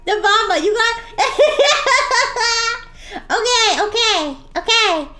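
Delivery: slime voice changer. Acted. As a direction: play the cheerful slime character through a voice changer. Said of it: "you're gonna hear my voice change".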